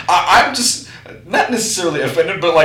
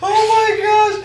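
high, laughing exclamation; excited; 3 dB louder than usual. Real, not acted. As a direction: squeal it with a laugh.

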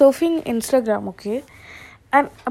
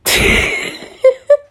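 laughing at himself; 5 dB louder than usual. Real, not acted. Laughs after singing it himself.

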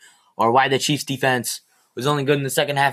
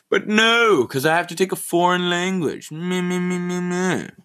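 mocking whine; 5 semitones higher, slower than usual. Mocking the foreign language rule.

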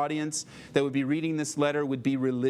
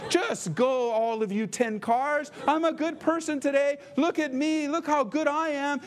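higher, proud self-praise, mildly hammed; showing off; 10 semitones higher than usual. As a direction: preen about your own goodness to the traffic. Showing off goodness to cars.

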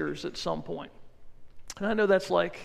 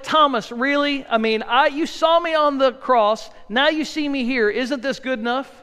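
higher, louder, fed-up telling-off; Jesus as the impatient teacher he never was, exasperated; exasperated scolding; 4 semitones higher, 5 dB louder than usual. Pretend. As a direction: scold a friend who should know better. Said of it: "that's not what Jesus said".

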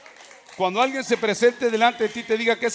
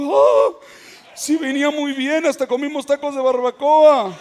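high, louder startled exclamation with a nervous laugh; startled pastor; 5 semitones higher, 3 dB louder than usual. Retold or imitated. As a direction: play the frightened pastor blurting it out. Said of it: "El pastor se asustó".

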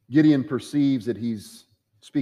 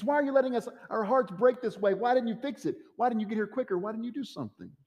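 higher, pleading questions that fade away; grieving sisters; 10 semitones higher than usual. Acted. Sisters asking Jesus why he was late, in a higher, pleading voice. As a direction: cry out to Jesus why he didn't come.

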